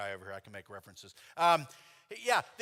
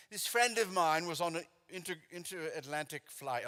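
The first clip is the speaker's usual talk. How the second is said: put-on British accent. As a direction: do the British writer, half in fun. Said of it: "he was saying it very Britishly".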